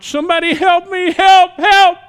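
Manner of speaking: high, loud desperate shout for help; a drowning boy screaming for help, rising to a full shout; cry for help. Retold or imitated. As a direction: yell for help from the river. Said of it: "he was crying out".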